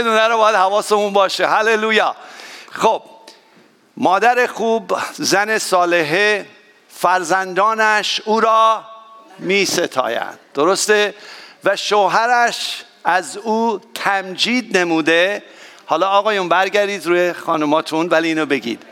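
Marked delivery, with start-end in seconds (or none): 4.0-10.4 slow, deliberate scripture reading; measured, solemn recitation; slow verse reading. Acted. Pastor reads a Bible verse. 11.6-15.4 slow, deliberate scripture reading; measured, solemn recitation; slow verse reading. Acted. Pastor continues reading the verse.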